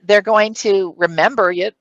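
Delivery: usual speaking voice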